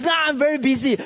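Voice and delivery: reluctant member making excuses; acted brush-off. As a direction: brush the leader off. Acted refusal to leader's request.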